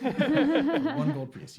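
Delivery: drawn out through a laugh. Acted. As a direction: stretch it slowly, laughing. GM voicing price, laughing.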